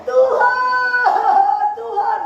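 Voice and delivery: high, drawn-out mock wail; imitated choking sobs. Preacher imitating Hizkia crying out to God, sobbing wail. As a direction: act out a sobbing cry to God. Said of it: "Hizkia menangis sampai tersedak-sedak".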